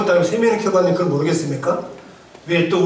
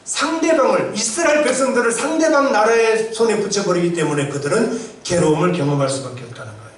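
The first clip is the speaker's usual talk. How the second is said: ordinary talk, nothing marked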